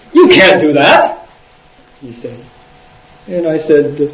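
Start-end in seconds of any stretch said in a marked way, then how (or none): 0.1-1.3 slow, louder imitated objection; mock indignant protest; shocked protest. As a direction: object as if appalled. Shocked monk protesting in story.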